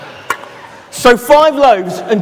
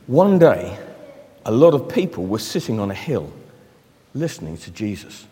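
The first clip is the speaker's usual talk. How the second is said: soft, low storytelling voice; reading a story to children, soft and low; story narration; 10 semitones lower, 5 dB quieter than usual. Acted. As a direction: draw the children in quietly. Reads a Bible story to children.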